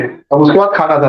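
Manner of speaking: ordinary talk, nothing marked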